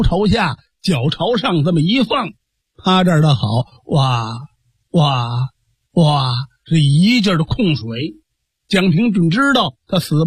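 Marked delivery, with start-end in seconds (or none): 3.8-6.3 low imitated water-spitting "wa"; mock retching gurgle. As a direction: spit out water, gagging. 吐水声模仿. (低沉模仿控水的"哇"声)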